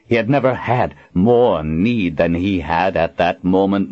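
ordinary delivery, nothing marked

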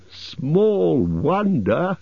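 high, very drawn-out mock echo; sing-song mocking disbelief. A narrator's reading. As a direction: throw the words back, slow and high. Elves mockingly echo butler's words.

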